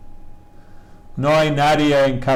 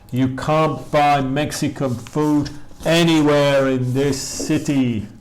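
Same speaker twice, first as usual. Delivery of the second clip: slow model reading; 2 semitones higher, slower than usual. Shown as a demonstration. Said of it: "teacher models corrected sentence slowly".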